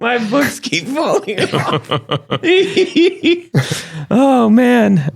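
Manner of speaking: repeating it through laughter; quoting him while cracking up; laughing. Retold or imitated. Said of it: "I didn't even pay for comedy night".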